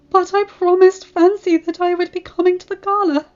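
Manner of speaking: high, pained plea near tears; tearful. Acted. Rarity grieving her promise to Fancy, high and tearful. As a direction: hold back tears. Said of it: "she said tearfully".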